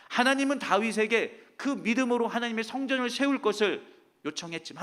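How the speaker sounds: normal talking voice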